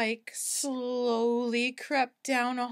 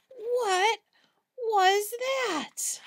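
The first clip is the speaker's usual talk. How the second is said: high, frightened question; scared; 8 semitones higher, slower than usual. Acted. Frightened cats ask each other, high and scared. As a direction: squeak it out in fear.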